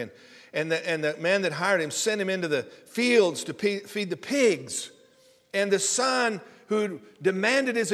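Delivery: loud, high, building sermon voice; preaching at full force. Acted. Pastor preaching the prodigal son to the congregation, loud and high.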